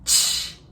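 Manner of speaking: voiceless tch, just the breath; tch sound. Shown as a demonstration. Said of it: "tem som de".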